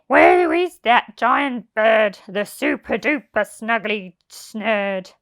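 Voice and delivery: loud, slow demanding voice; impatient thief. Acted. Len demanding the snurd, loud and slow. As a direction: demand the bird angrily. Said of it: "And Len said".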